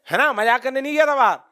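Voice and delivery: usual speaking voice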